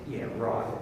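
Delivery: low, quiet, unconvinced "Yeah, right."; sarcastic yeah right. Retold or imitated. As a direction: mutter your disbelief at the salesman. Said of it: "what are you thinking?".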